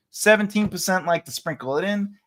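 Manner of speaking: normal talking voice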